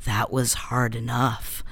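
very low, slow, drawn-out celebrity drawl; deep, unhurried interview voice; Lindsay Lohan. Retold or imitated. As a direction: drop the voice right down and take your time. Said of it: "That was a good Lindsay".

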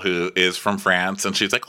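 ordinary delivery

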